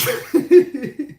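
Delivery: high, loud giggle; real amused giggling; laugh. Real, not acted. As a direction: burst out in high-pitched laughter. Amused by viewer's comment.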